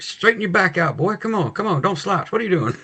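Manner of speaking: mock-scold in a high, hushed voice; put-on fussy elder telling someone off. Imitating someone scolding about slouching.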